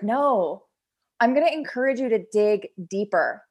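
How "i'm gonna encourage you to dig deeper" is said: ordinary talk, nothing marked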